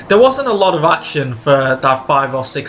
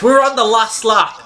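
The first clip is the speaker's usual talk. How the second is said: higher, eager call; excited announcement; 5 semitones higher than usual. Real, not acted. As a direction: announce it with a thrill. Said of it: "That's how you say it".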